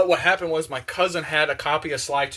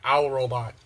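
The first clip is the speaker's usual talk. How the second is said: ordinary delivery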